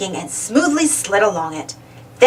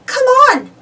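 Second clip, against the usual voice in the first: eager; 3 dB louder than usual. A narrator's reading. Uniqua leading bravely.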